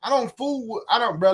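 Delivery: normal talking voice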